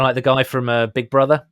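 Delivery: usual speaking voice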